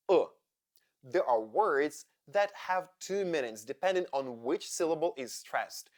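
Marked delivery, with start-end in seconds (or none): 0.1-0.3 short isolated 'uh'; a lax, neutral 'uh' on its own; schwa sound. Shown as a demonstration. Teacher demonstrating a vowel to learners, schwa sound. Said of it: "sounds like schwa".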